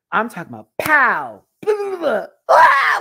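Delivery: high loud imitated pow and scream; mock crash and scream. Hamster hits ground, mock scream. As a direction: mimic the thud then the baby's scream.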